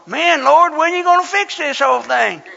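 high, loud, exasperated mock plea; mock complaining. Preacher mimics a headless martyr griping to the Lord, mock complaining.

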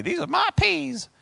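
wife's voice, affectionately mocked; imitating his wife. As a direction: lightly mimic the wife's catchphrase. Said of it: "she's always telling me".

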